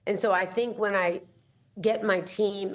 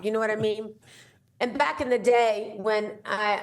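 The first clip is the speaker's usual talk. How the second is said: ordinary delivery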